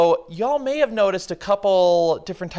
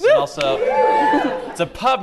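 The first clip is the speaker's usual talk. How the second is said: laughing; 5 dB louder, slower than usual. Real, not acted. Host laughing at audience.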